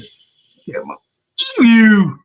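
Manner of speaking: whispered start, then a loud, high "believe you"; husband's catchphrase. Retold or imitated. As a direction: bursts out with mock disbelief. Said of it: "That's how he said it".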